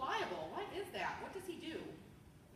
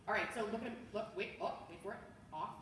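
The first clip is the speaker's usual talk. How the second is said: ordinary talk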